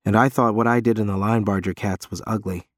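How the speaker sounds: plain reading voice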